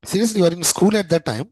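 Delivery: real astonishment. Real, not acted. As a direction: react in disbelief, pitch jumping up. Astonished at students' young age.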